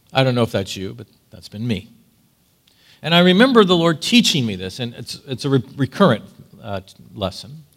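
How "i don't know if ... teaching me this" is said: normal talking voice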